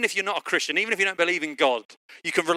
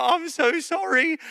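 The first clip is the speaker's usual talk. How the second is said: high, drawn-out imitated apology; mock distraught apology; 12 semitones higher, slower than usual. Wife apologising after reversing into a car, mock distraught. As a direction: plead it high and slow, hammed-up.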